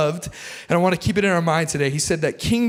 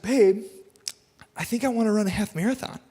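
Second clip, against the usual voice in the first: higher, hesitant imitation of a wife; put-on wife voice; 4 semitones higher than usual. Wife pitches a half marathon to husband, put-on voice. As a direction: put on a wife's voice, tentatively raised. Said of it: "I don't know why I talk like that. My wife doesn't talk like that".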